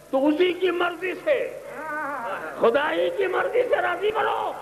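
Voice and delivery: high, slow, swelling sermon ending in a shout; full-force preaching. Acted. Preacher exhorting believers, slow and climbing to a shout.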